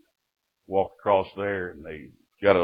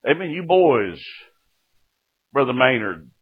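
ordinary talk, nothing marked

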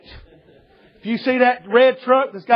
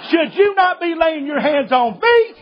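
higher, laughing, slow mock protest; mock indignation; 5 semitones higher, slower than usual. Preacher retelling to the policeman who asked for prayer, laughing mock protest. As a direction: play up the outrage, half laughing.

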